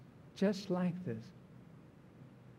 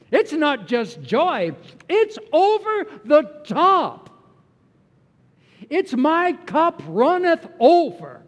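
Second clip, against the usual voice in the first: much higher and louder, slow, bursting exclamation; over-the-top joy; 10 semitones higher, 11 dB louder than usual. Acted. Pastor telling his congregation of joy, ecstatic. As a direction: shout it out like it can't be contained.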